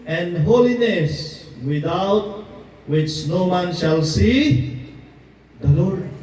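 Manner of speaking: slow, measured verse reading from the pulpit; preacher reading a Bible verse to the congregation, slow and solemn; scripture reading. Acted. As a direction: read the verse slowly and solemnly. Reads a Bible verse aloud.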